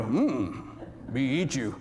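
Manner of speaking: low, soft, hungry caveman voice; mock cannibal. Hungry bunker mate eyeing the preacher, low mock caveman grunt. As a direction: play a starving man eyeing his friend. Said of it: "he'll look at me and go".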